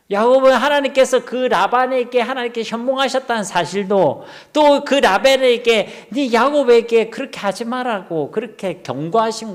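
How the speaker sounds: high, loud preaching voice, slowing to a crawl on 또 그 라반에게; impassioned sermon climax; fervent preaching. Acted. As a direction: preach it out with rising fervour. Preaching at full force.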